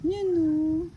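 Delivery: ordinary talk, nothing marked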